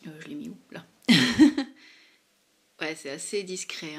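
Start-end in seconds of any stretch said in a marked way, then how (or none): 1.1-1.9 a sudden loud laugh at herself; burst of laughter. Real, not acted. Vlogger laughs at her own faint swatch on camera.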